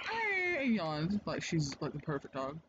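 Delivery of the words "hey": a high squeaky yawn on hey; puppy yawn. Retold or imitated. Imitating the yawning puppy for Jess, high squeaky yawn. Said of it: "the puppy went".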